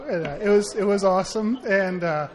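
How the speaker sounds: ordinary talk, nothing marked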